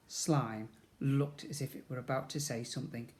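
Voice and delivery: plain reading voice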